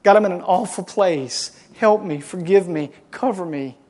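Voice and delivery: loud, desperate cry for help; a pleading cry of someone at rock bottom; crying out to God. Pretend. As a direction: cry out to God from a bad place. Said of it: "Just cry out to Him and say".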